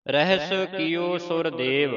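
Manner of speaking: slow, measured verse recitation; slow devotional reading; scripture recitation. Shown as a demonstration. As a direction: recite with long drawn-out vowels.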